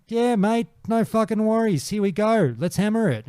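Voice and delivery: high, upbeat easygoing bloke; keen mage agreeing to help, high and cheerful; cheery mate. Retold or imitated.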